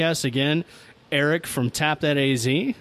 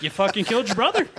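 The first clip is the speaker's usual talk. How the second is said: high-pitched, laughing mock outrage; mock panic; 10 semitones higher than usual. Kid yelling at cousin who hit his brother, mock panic. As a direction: protest in a high, hammed-up panic.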